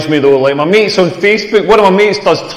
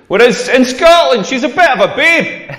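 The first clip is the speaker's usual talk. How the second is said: ordinary talk, nothing marked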